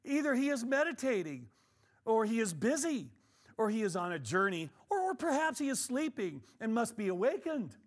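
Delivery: high, loud, taunting scripture reading; mock the prophets of Baal from the pulpit. Elijah taunting the prophets of Baal, mocking and loud. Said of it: "Elijah mocked them and said".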